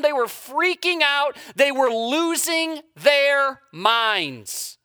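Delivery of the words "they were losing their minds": ordinary talk, nothing marked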